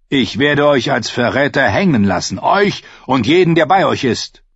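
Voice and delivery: a king promising no mercy; threatening king. A narrator's reading. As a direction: warn the castellan with cold menace. Narrator voices King Stannis.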